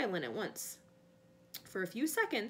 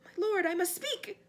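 high, loud, urgent plea; frightened servant interrupting his master; panicked; 9 semitones higher, 5 dB louder than usual. A narrator's reading. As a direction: beg to be heard in panic. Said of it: "panic in his voice now".

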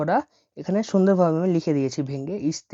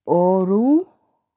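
অরুকানা said very slowly and a bit louder; slow model word; 2 semitones higher, 5 dB louder, much slower than usual. Shown as a demonstration. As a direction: spell out অরুকানা syllable by syllable. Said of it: "এখানে বাংলায় লেখা আছে".

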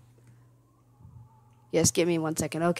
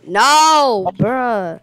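hyped gamer bro; 3 semitones higher, 7 dB louder than usual. Real, not acted. Lag frustration in game.

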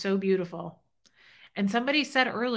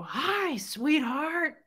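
raspy, high-pitched imitation of a hearty greeting; Fred's big voice; 7 semitones higher, much slower than usual. Widow recalling how he would greet her, big raspy voice. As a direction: put on his gravelly hello, no louder than usual. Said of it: "he was real big, loud voice".

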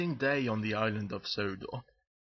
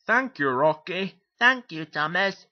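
loud, higher character voices; grateful little engines; 5 semitones higher, 7 dB louder than usual. Acted. James and Percy thanking their rescuers, loud and grateful. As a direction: chirp the thanks out loudly.

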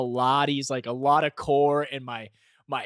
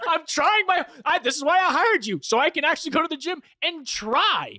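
high-pitched, louder mock protest; dramatic complaining; 13 semitones higher, 4 dB louder than usual. Client retelling his protest to the trainer, high dramatic complaint. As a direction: whine it out, hammed-up and emotional. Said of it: "I'm, like, complaining".

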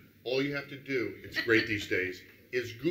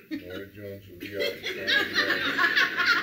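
mock calm announcement; 5 semitones lower, 7 dB louder, slower than usual. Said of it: "I got them too excited going".

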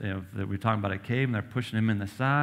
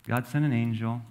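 usual speaking voice

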